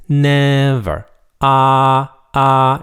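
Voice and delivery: NEVER and AH held high, loud and level; high flat tone. Shown as a demonstration. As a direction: hold NEVER, then AH on one high note. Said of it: "It's a high flat tone".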